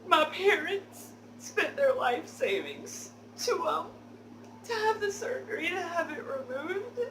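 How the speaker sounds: high, loud fake sobbing with laughter breaking through; fake crying through laughs. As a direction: ham up the fake tears, barely holding a laugh. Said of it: "fake tears that I can create".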